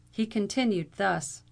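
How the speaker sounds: ordinary delivery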